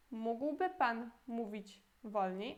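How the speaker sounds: slow second model of the same question; slow teaching repeat. Shown as a demonstration. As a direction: repeat it slowly so they can copy. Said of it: "E a pergunta toda".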